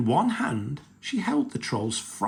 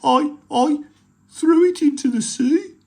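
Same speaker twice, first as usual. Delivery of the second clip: high, loud frightened stammer; troll caught by angry pirates, afraid; scared troll; 9 dB louder than usual. Acted. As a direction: stammer it out in panic.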